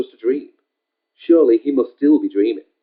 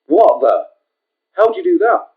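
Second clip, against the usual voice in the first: sudden high, startled outburst; shocked; 10 semitones higher, 5 dB louder than usual. A narrator's reading. Richard shocked at his healed finger, high startled cry. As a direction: blurt it out in disbelief. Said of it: "Richard was shocked".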